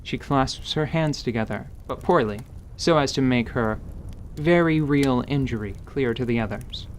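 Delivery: usual speaking voice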